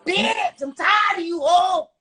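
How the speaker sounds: high laughing yell; laughing high mock shout at the rival; retold yell. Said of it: "I was like".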